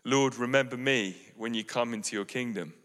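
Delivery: soft, hushed plea of a dying thief; moving plea. Acted. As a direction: ask gently, almost breaking. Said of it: "turns to him very movingly and says".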